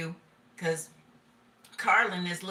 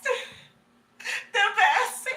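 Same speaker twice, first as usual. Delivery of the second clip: much higher, laughing through the word; squealing laugh; 14 semitones higher, 4 dB louder than usual. Real, not acted. Gushing about the best to viewers, squealing with laughter. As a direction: squeal it out giddy. Gushing over the church crush.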